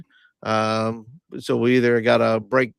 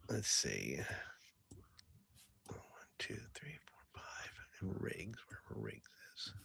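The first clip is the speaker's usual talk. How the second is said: barely audible whispered counting; muttered under the breath; whispering to self; 8 semitones lower, 25 dB quieter than usual. Real, not acted. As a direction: think aloud in a tiny whisper. Counting options under his breath.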